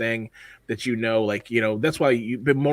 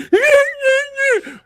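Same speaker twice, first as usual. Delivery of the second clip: high, loud mock wail; hammed-up fake sobbing squeal; overacted sad crying; 7 dB louder than usual. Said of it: "like, overacted sad".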